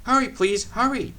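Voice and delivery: eager engine begging to hurry; urgent pleading. A narrator's reading. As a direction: hurry them along. Engine urging at stations.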